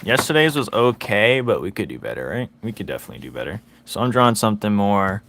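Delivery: ordinary delivery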